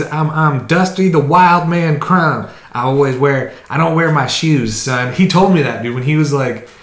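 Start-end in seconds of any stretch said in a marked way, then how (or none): 0.0-5.1 loud, higher swaggering swamp-man voice; playful imitation of a brash friend; mimicking Dusty. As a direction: ham up the wild man's boast. Impersonating Dusty's redneck bravado.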